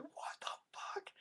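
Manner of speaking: slow, high, breathless disbelieving whisper; retold whispered outburst after punching the roof; incredulous whisper. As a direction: hiss it out in stunned disbelief. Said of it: "I lost it".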